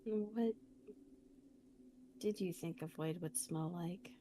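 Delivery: soft, breathy whispered question; whispering. Real, not acted. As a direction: whisper it, voice gone to air.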